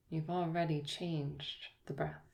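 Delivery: ordinary talk, nothing marked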